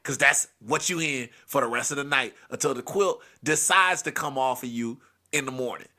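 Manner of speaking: ordinary talk, nothing marked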